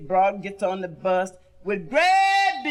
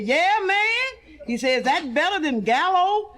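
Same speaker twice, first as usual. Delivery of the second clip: wino voice; 7 semitones higher, slower than usual. Acted. Wino punchline.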